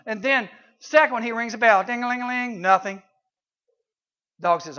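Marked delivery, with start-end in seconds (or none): none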